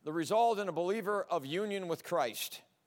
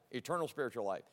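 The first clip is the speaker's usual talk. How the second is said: much softer and lower, "Eternal" leaned on; quiet emphatic echo; 8 semitones lower, 6 dB quieter, faster than usual. Shown as a demonstration. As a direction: repeat it softly to drive it home. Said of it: "we have eternal spiritual life".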